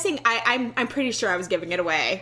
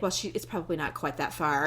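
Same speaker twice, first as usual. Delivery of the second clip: quiet self-denial; 7 semitones lower, 6 dB quieter than usual. Retold or imitated.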